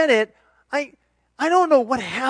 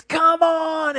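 higher, louder come on at the refs; mock yell; 10 semitones higher, 5 dB louder than usual. Parent in the stands yelling at the refs. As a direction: call out at the referee. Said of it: "you're yelling at the referees".

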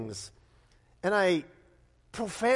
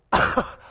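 short embarrassed breath of a laugh; sheepish breathy laugh; 8 dB louder than usual. Retold or imitated. Caught cursing in Denny's, an embarrassed laugh.

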